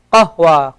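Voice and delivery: qahwa with a deep throat q and a breathy h; Arabic qahwa. Shown as a demonstration. Teacher gives the Arabic word for coffee, qahwa. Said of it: "Qahwa is coffee".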